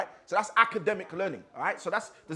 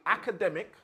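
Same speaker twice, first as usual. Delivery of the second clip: ACADEMIC set apart with deliberate emphasis; emphasized ACADEMIC. Shown as a demonstration. Teacher naming the first way of learning, ACADEMIC emphasized. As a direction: lean on ACADEMIC as a term. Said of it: "to emphasize academic".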